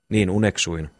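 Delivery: ordinary delivery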